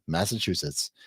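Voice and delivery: ordinary talk, nothing marked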